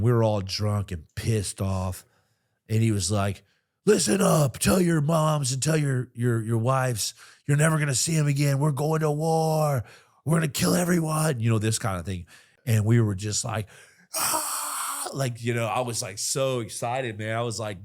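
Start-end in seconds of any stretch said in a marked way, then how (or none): 3.9-11.4 loud, high drill-sergeant bellow; emotional shouting. Retold or imitated. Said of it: "kind of half crying, real emotional and drunk". 14.1-15.1 high-pitched whoop of excitement; excited whoop. Retold or imitated. Cheering about going to war.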